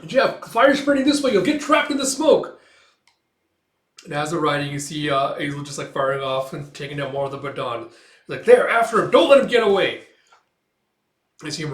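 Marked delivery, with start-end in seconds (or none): 0.0-2.6 high, loud warning shout; urgent warning. Retold or imitated. Warning comrades about the smoke, urgent shout. 8.5-10.1 high, loud chase command; rally pursuers urgently; shouted order. Retold or imitated. Pursuer sends men after the rider, shouted order.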